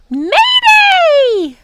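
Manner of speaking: very high, loud drawn-out shriek; shrieked alarm. A narrator's reading. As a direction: screech the alarm like a bird. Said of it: "she shrieked".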